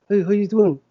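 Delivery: imitating a Kiwi greeting. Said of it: "and they're like".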